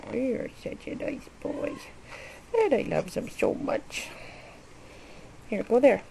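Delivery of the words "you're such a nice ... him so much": soft whispered praise; whispering. Real, not acted. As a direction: whisper it tenderly to the bird. Praising pet bird.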